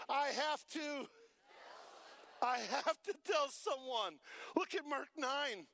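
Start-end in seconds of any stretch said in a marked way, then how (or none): 0.1-4.2 loud, urgent insisting voice; loud insistence. Retold or imitated. Healed man insisting he must tell, loud urgent cry. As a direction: shout that you must tell.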